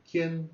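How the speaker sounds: quién rising high at the end; rising question tune. Shown as a demonstration. Teacher showing students the question tune, rising pitch. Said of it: "The sound goes up".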